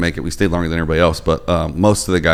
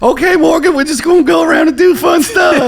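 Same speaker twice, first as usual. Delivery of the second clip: high, loud, bubbly host voice; perky radio lady; 6 dB louder than usual. Retold or imitated. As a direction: chirp like a cheerful morning host. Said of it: "that lady was like".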